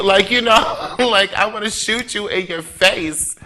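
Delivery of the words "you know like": ordinary delivery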